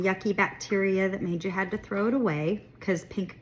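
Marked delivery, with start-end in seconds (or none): none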